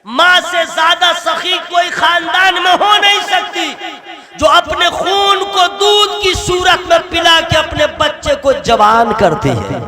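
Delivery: loud, high impassioned sermon; fiery preaching. Acted. Preacher to the gathering, loud and impassioned. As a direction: preach it out at full force.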